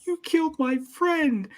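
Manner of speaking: put-on high, slow, whiny kid voice; high, drawn-out mock sob voice; mock whiny Baki. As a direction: whine it like a hurt little kid. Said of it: "And Baki's like".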